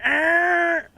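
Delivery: a high, loud blaring horn noise; copying the golf course storm horn, high blare; horn imitation. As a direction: blast it like a siren.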